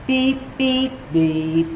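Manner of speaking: imitated test tones. Shown as a demonstration. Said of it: "they sound like this".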